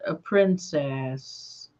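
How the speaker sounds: ordinary talk, nothing marked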